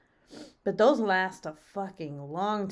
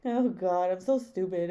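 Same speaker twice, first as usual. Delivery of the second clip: usual speaking voice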